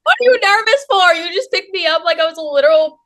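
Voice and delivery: high, loud incredulous teasing; incredulous squeal. Retold or imitated. Fan teases the wrestler who lifted her, excited. Teasing idol about strength.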